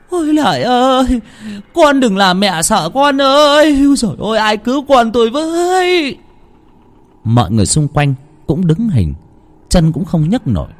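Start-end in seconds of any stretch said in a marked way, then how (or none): 0.1-6.2 panicked pleading. A narrator's reading. Mother pleads as Lợi climbs, panicked wailing. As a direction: beg desperately, stretching every cry. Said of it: "van xin".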